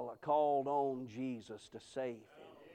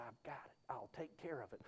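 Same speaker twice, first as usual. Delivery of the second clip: very soft, lowered aside; confidential reply; 5 semitones lower, 16 dB quieter than usual. Retold or imitated. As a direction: quietly reassure the funeral director under your voice. Quiet aside to funeral director.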